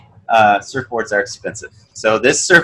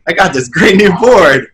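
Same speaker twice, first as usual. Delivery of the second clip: much higher and louder, bursting with excitement; retelling his own giddy joy at the new board; thrilled customer; 9 semitones higher, 6 dB louder than usual. Retold or imitated. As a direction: gush like a kid with a new toy. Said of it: "and I was just like".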